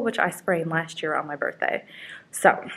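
ordinary talk, nothing marked

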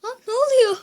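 high loud shocked yell into laugh; panicked shout. Real, not acted.

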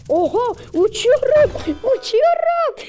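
thrilled falsetto cry of flying; excited squeal. Real, not acted. Car finally flies in game.

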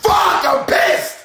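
laughing fake outrage; laughing shout. Real, not acted. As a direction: yell it while cracking up.

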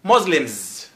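ordinary delivery

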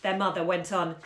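usual speaking voice

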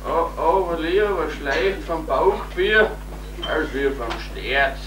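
hungover groaning. Acted. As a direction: groan like a sick hangover. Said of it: "schlecht ist mir".

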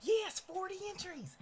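excited cheer. Real, not acted. Vlogger cheering a viewer's entries, excited. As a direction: celebrate it out loud.

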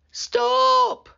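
loud, high drawn-out shout; high shouted command; boy's cry. A narrator's reading. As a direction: cry out as the alarmed boy. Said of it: "cried the boy".

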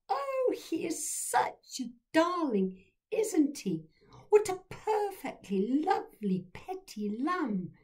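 posh voice. A narrator's reading. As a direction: gush over the lamb like a posh aunt. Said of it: "in her bosh, posh voice".

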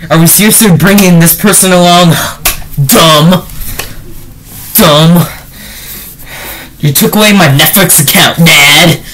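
lightly imitated whiny child; mock whining. Reviewer mocking a whiny Padawan complaining to Dad, whiny. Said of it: "he's all about whining".

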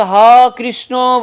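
devotee's cry. Retold or imitated. Devotee crying out to Krishna, a slow drawn-out loud call. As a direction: cry out the name like a longing devotee. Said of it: "চিৎকার করে".

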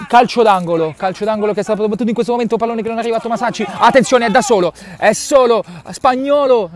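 fast, high, rushing play-by-play; breathless italian goal commentary. Real, not acted. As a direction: rattle it off as the ball flies in. Corner, frantic fast call. (telecronaca affannata e veloce)

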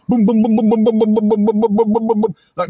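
loud mock barrage of booms; loud firework booms. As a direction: blast them out like fireworks. Imitating fireworks going off.

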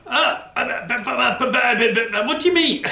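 high flustered puppet stammer. Acted. Puppet protests wrong answer.

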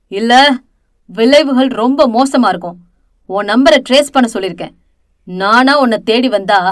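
hammed-up villain rage; menacing anger. A narrator's reading. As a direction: lean in and bully her. Villain threatens her on the phone.

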